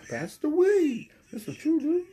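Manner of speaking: squeaky put-on stoner voice; goofy high voice. Vlogger clowning in a high squeaky stoner voice. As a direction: play a silly high voice. High-pitched side voice, far timbre.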